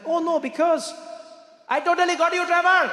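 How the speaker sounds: put-on very high, loud, gloating Indian accent. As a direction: crow over a prank in an Indian accent. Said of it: "you, you Indian?".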